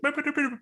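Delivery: very high quick droid chirps; droid beeping. Acted. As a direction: imitate an astromech's electronic beeps. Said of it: "R five is like".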